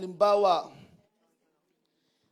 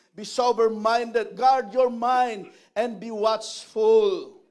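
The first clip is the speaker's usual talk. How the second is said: loud, slow, every word hammered out; forceful preaching; 13 dB louder, much slower than usual. Acted. Pastor preaching loudly and slowly to his congregation. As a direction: preach it slowly to the whole room.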